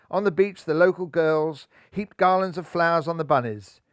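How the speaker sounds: ordinary delivery, nothing marked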